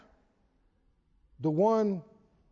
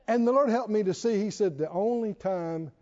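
normal talking voice

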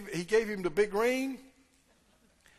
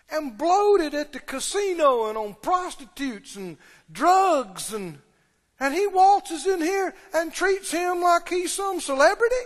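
fuming; 6 semitones higher than usual. Acted. Older son ranting about the boy's welcome, fuming. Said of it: "He's fuming".

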